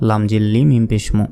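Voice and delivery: usual speaking voice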